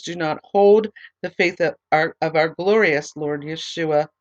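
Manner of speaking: normal talking voice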